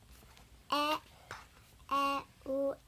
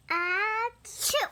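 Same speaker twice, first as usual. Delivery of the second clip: sneeze; 5 semitones higher, 6 dB louder than usual. Acted. Acting a sneeze from the book.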